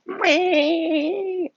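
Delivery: very high, drawn-out whiny bleat; mock crying wail. Kid pinned on the ground, mock crying. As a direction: imitate a kid's crying with one long squeal. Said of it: "he starts crying. He's like".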